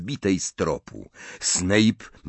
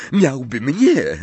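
louder, higher, fervent; passionate cry; 6 semitones higher, 6 dB louder, slower than usual. Acted. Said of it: "zawołała z pasją Bellatrix".

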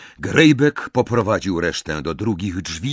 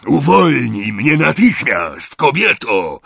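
loud, slowed, raised-voice order; angry demand; 5 semitones higher, 6 dB louder than usual. A narrator's reading. Pinned Greyback orders Bellatrix to free him, angry. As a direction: demand release in a rough temper.

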